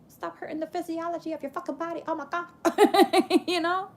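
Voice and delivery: high, quick exasperated plea; amused exasperation. Real, not acted. Urging listeners to stop holding tears in, amused exasperation. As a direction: plead in a rush, about to laugh.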